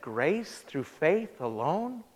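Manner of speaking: low, hushed, drawn-out echo; stunned question. Retold or imitated. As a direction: let it sink in, half disbelieving. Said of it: "She said".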